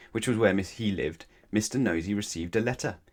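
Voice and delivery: ordinary delivery, nothing marked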